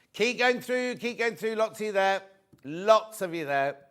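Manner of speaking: ordinary talk, nothing marked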